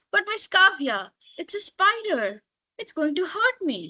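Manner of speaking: high, pleading astonishment; a boy protesting in disbelief; astounded. Acted. Ritik protests to his teacher, mildly astounded. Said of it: "an astounded Ritik replied".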